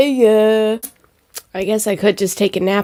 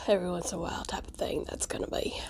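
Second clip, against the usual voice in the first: quick breathy whisper; fast whisper; 16 dB quieter, much faster than usual. Real, not acted. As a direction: rush it in a whisper. Quiet aside.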